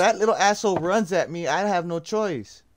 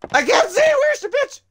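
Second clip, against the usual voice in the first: high frightened yell; panicked shout; 4 dB louder than usual. Real, not acted. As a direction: scream it in panic. Panicked in dark horror game.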